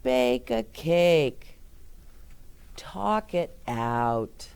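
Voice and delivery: slow, careful picture-book reading; reading to children. Acted. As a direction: read it slowly to little kids. Reads picture book aloud.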